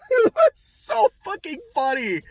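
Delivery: high, breaking into laughter; can't stop laughing. Real, not acted. Still laughing at the memory.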